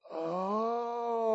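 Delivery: high, soft gasped oh; an aha breath of discovery; a quiet gasping oh; sudden realization. Retold or imitated. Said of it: "And you go".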